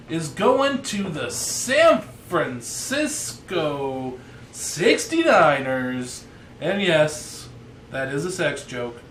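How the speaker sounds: slow, drawn-out delivery; over-enunciating every word slowly; talking slow. Real, not acted. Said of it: "Why are you talking so slow?".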